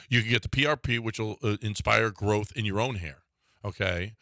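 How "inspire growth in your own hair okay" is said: usual speaking voice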